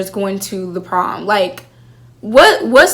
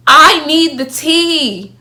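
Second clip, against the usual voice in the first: higher and slow, a drawn-out plea; pleading for gossip; 6 semitones higher, 4 dB louder, much slower than usual. Real, not acted. Fan begging the show for answers, slow high plea. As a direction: beg like you're starving for it.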